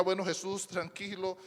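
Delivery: soft, gentle comforting voice; calm him down softly like a caring angel; an angel softly comforting a frightened Jesus; tender reassurance. Retold or imitated. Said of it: "yo me imagino le diría".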